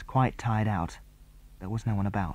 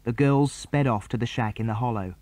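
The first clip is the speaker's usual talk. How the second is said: usual speaking voice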